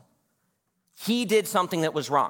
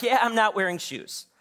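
put-on high, matter-of-fact kid voice; Judah; 7 semitones higher than usual. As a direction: shrug it off like a six-year-old. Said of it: "And he's like".

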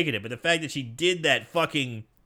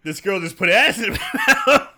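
louder, higher, breaking into laughter; laughing disbelief; 6 semitones higher, 6 dB louder than usual. Real, not acted. Telling friends a high school story, laughing in disbelief. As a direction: exclaim it through a laugh. Laughs while retelling his shock.

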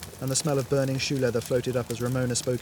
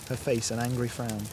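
usual speaking voice

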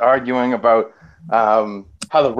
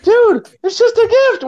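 much higher voice, laughing through it; 4 dB louder than usual. Retold or imitated. Teller laughing at his panicking friend, high laughing voice. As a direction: laugh while insisting.

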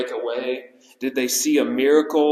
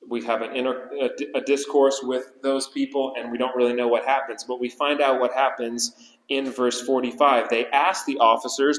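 usual speaking voice

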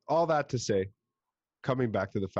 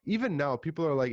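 ordinary talk

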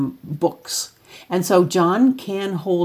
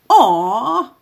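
son's joke voice; 6 semitones higher, 6 dB louder than usual. Retold or imitated. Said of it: "But he'll go".

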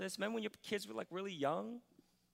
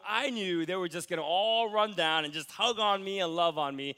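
usual speaking voice

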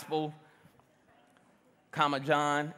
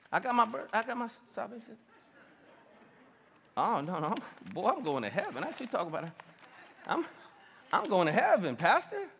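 softer, lower voice of an imitated churchgoer; mock congregant protesting; 3 semitones lower, 9 dB quieter than usual. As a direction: lightly play a congregant making quiet excuses. Acts out complacent congregant answering him.

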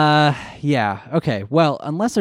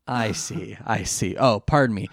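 normal talking voice

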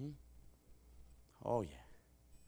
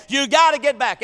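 hollering; 9 semitones higher, 11 dB louder than usual. Retold or imitated. Preacher hollering to the youth in the water. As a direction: holler them back to shore. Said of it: "I'm saying".

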